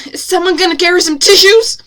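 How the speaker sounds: high, loud indignant demand; almost shouting. A narrator's reading. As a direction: call it out just short of a shout. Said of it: "she said, almost shouting".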